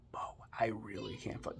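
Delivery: whispered disbelief; amazed whisper. Real, not acted. Whispering to viewers, overwhelmed by the discovery. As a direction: breathe it out, overwhelmed.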